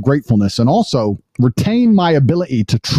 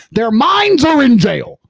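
rising scorn; heated rant; 10 semitones higher than usual. Real, not acted. Host ranting at critics to listeners, heated and high.

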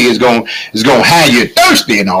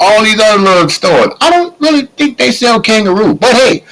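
higher and higher in disbelief; incredulous; 11 semitones higher than usual. Real, not acted. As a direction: sound like you can't believe it. Doubting kangaroo in stores.

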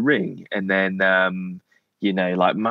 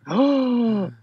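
very high mortified gasp of oh; 14 semitones higher than usual. Retold or imitated. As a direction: caught out and horrified. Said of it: "poor Kenny was just like".

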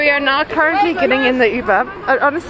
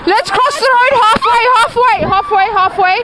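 calling out; 6 semitones higher than usual. Real, not acted. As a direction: call the group across the road. Herding friends across busy road.

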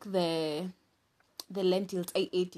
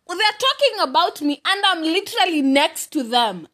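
loud and higher, indignant; outraged rant; 9 semitones higher, 5 dB louder than usual. Retold or imitated. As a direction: fire it at the gossips. Outraged rant at gossiping mothers.

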